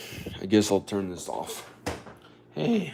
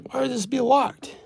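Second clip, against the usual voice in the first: mock puzzlement. Puzzled at a locked door.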